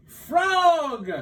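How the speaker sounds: high, loud call; boastful cry. Acted. Toad boasting to Frog from the stage, loud call. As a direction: show off to your friend. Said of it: "cried Toad".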